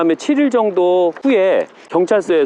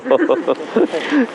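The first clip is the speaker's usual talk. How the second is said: real chuckle at oneself; 2 semitones higher than usual. Real, not acted. Streamer laughing after saying no dialect today, amused chuckle. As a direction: break into a short string of chuckles.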